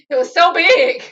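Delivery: loud burst on the last words; exaggerated, loud exclamation; mock overwhelmed. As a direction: imitate her exclaiming in awe. Mimics her awed complaint.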